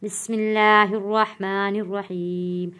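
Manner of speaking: रुक-रुक कर तिलावत; Quranic recitation. Acted. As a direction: recite the greeting and blessing devoutly. Opening recitation before the lesson.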